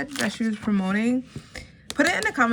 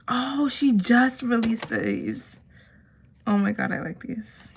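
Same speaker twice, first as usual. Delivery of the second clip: usual speaking voice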